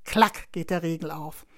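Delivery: ordinary delivery, nothing marked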